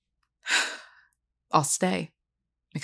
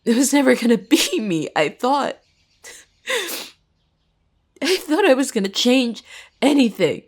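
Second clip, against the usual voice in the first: bitter despair; 2 semitones higher, 4 dB louder than usual. Acted. Student admits hope of changing things failed, anguished. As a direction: pour out bitter regret at having hoped. Jess despairs at being passed over.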